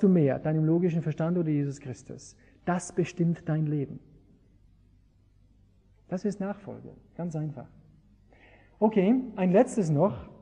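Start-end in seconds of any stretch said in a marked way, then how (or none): none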